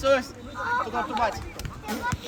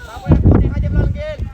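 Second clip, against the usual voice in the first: loud call; 14 dB louder, faster than usual. Real, not acted. Coach calling player across pitch.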